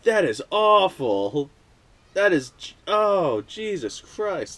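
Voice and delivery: high, strained groan of disgust; real revulsion. Real, not acted. Vlogger rubbing gritty rust on fingers, high disgusted groaning. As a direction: squirm through every word of it.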